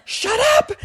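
very high imitation yell; mock yell. Mom yelling at her next son, high mock yell. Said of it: "She yells at her son".

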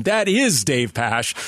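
usual speaking voice

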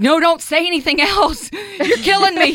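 high pleading with a laugh; laughing plea. Retold or imitated. Begging the friend to stop, high and laughing. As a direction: beg her to stop, half laughing. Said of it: "I said something like".

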